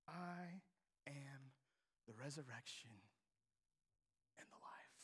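soft, low whisper fading out; hushed declaration. Shown as a demonstration. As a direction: breathe the words almost to nothing. First take, hushed.

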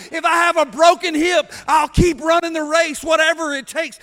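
fiery preacher cry of never stopping; preaching at full force. Real, not acted. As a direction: build the sermon to a roaring peak.

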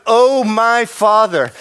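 slow, loud anguished plea; crying out. Acted. Preacher voicing Jesus praying in Gethsemane. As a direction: pour it out, face on the ground. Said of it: "he's crying out".

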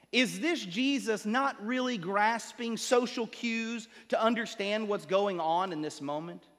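ordinary delivery

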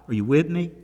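normal talking voice

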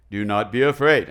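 booming low angel proclamation. Acted. Preacher doing his angel voice for the congregation. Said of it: "I'm gonna do my angel voice".

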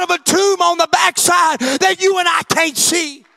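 loud, rising sermon cadence, pushing each line; full-force preaching. Acted. Pastor preaching hard to his church, full force. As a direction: preach it out to the congregation.

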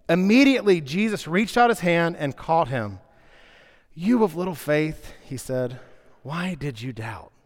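reading the passage aloud to the congregation; scripture reading. Acted. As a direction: read it like a story for the church. Reads the Bible passage aloud.

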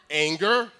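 usual speaking voice

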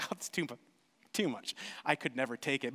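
soft, low, falsely modest refusal; mock polite refusal. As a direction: play the humble guest who turns down a gift.

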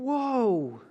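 solemn prophetic woe; heavy woe. Shown as a demonstration. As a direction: lean into each woe. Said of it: "he's saying".

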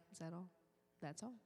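ordinary talk, nothing marked

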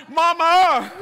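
loud high cry for mama; mock cry out in pain; dad crying out after the toe injury, loud; pained yell. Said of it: "All I could say was".

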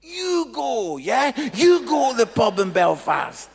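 loud, high retort handing the job back; loud mock reply to God; telling God back. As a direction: throw it back at God, loudly. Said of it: "eventually I'm like".

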